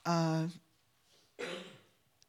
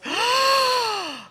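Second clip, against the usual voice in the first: imitated long, high gasp; mock startled gasp. Young guy turns around and sees her, mock startled gasp. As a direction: gasp in shock, hammed up. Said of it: "he turns around and he goes".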